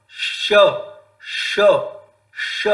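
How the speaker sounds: hushed hissing श sounds; demonstrating श. Shown as a demonstration. Teacher demonstrates sibilant placement to students. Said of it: "देखो सबसे पहला जो शकार".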